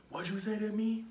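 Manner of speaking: a hammed-up drunk picking a fight; drunk guy. Drunk stranger confronting friends outside the store, mock menacing. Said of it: "he was like".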